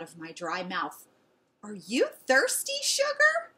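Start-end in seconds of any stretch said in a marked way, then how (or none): 1.6-3.5 high, rising coo to a pet; kind lady. A narrator's reading. Lady to a thirsty stray dog, high cooing. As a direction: coo it sweetly to a dog, pitch climbing.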